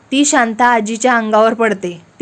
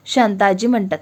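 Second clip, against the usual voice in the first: plain reading voice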